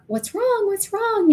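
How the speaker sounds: high, anxious what's wrong; worried parent. Retold or imitated. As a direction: ask it up high like a startled parent. Parent asks scared child.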